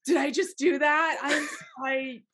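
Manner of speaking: high-pitched, flustered scrambling; hammed-up high-voiced eagerness, tripping over the words. Caught-out actor offering the director an accent, flustered. Flustered reply to being called out.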